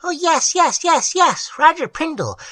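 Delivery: high, eager tiny voices; excited troll chorus. A narrator's reading. Trolls eagerly agreeing to Prindle, high and excited. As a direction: squeal yes like delighted sprites.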